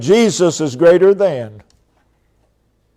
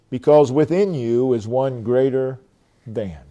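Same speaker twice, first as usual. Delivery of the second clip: ordinary talk, nothing marked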